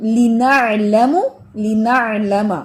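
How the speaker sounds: لنعلم slow, ain squeezed from mid-throat; لنعلم drawn out, ain pushed up from the throat; correct ain from throat. Shown as a demonstration. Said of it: "وہ یہی چیز سمجھانا ہے".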